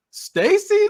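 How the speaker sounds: very high, loud mock disbelief; startled recognition. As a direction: squeal the name as a shocked question. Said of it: "Mike recognized him, goes".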